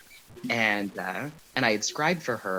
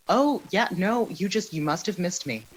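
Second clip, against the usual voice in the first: mildly high-pitched, polite cover-up; awkward brush-off; 7 semitones higher than usual. Retold or imitated. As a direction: start high and ease down, brushing it off. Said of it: "And I'd be like".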